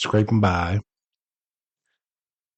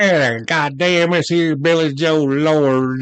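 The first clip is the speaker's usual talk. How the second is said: hammed-up, drawn-out higher voice; slow, exaggerated impression, pitched up; Billy Joe Lord; 5 semitones higher, slower than usual. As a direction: stretch every word high in a mock character voice. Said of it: "reading this in the Billy Joe Lord voice".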